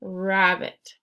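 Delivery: slow read-aloud. Shown as a demonstration. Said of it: "if I look at it".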